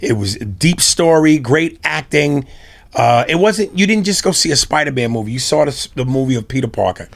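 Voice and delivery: ordinary talk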